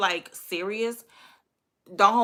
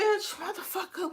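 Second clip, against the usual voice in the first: exasperated outburst; 11 semitones higher, 5 dB quieter than usual. Retold or imitated. Retold exasperation at date's guessing game.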